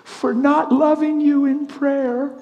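high, slow tearful confession; crying. Retold or imitated. Preacher voicing wife confessing to husband, crying. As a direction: imitate her weeping apology, drawn out. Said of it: "crying profusely".

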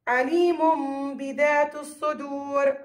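عليم بذات الصدور slowly, with a held m before the ب; measured recitation of عليم بذات الصدور, lingering on the m; iqlab pronunciation. Shown as a demonstration. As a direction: stretch the tanween of عليم into a hummed m. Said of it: "بدلنا لميم".

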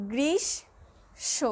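গ্রীষ্ম stretched very slowly, every sound held apart; drawn-out demo. Shown as a demonstration. Teacher to students, slow careful demo of গ্রীষ্ম. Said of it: "দেখো".

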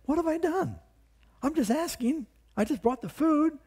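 put-on a wide-eyed kid brother defending himself; youthful David, hurt and high-pitched; indignant young David.

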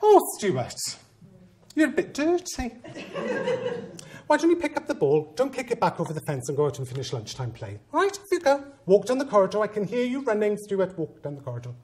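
lightly mocking a sweet voice to the child; gentle head teacher. Head speaking kindly to child.

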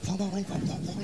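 sly soft nudging; hushed urging. Acted. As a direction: quietly goad the kid to tell dad. Mother urging kid secretly.

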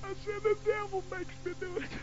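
high, soft mock-tearful whimper; fake crying. Boy fakes crying to his mother, high whimpering excuse. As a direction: fake tears to get out of trouble. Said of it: "So I clouded up real quick".

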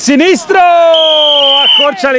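high, slow call of the goal; goal commentary. Acted. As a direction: stretch the call out. (telecronaca del gol)

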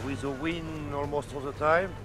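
ordinary talk

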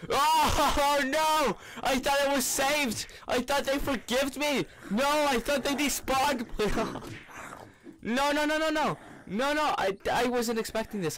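high-pitched frantic pleading; panicked wailing. Real, not acted. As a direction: cry out in alarm at a sudden threat. Zombies suddenly return, player panics.

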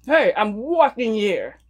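slow, hammed-up New York accent; drawn-out New York "wawking". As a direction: call it out like a mock New Yorker. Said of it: "learn the accent".

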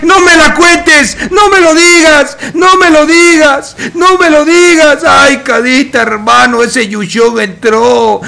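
very high, loud esports screaming; ecstatic disbelieving shouting. Real, not acted. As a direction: build to a scream, then repeat in high-pitched disbelief. Commentator explodes at a huge play.

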